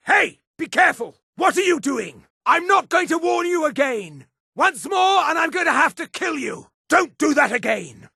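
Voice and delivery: high, alarmed shouts; angry warning. Acted. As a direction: protest sharply at being attacked by an ally. Warns ally who keeps hitting.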